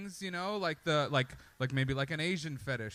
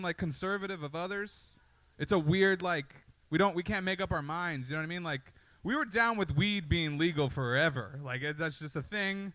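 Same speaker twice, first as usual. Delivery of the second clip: ordinary talk, nothing marked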